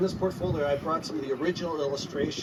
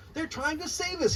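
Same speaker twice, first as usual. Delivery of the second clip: a mild gleeful call, pitched up; shouted with glee; 14 semitones higher than usual. A narrator's reading. McGee realising the whales will save him, mild gleeful shout. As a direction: let the joy lift it rather than the volume. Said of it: "he shouted with glee".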